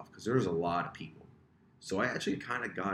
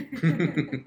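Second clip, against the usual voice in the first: laughing; 9 semitones higher, 7 dB louder than usual. Real, not acted. Laughing joke about sisters.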